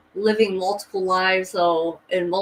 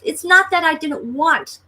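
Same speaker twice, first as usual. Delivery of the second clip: emphatic insistence; 9 semitones higher, 4 dB louder than usual. Shown as a demonstration. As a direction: stretch it out high, begging to be believed. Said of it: "It's not that I didn't want to believe it".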